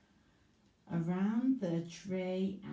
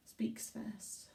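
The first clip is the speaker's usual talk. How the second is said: whisper; 9 dB quieter than usual. Real, not acted. Teacher whispering question.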